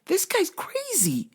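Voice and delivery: high, wide-eyed disbelief; startled realization. Retold or imitated. Watching the guy yell at FedEx, high startled disbelief. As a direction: voice up high in shocked relief. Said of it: "And I was like".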